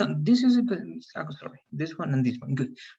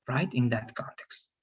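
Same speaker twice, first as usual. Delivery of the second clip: ordinary talk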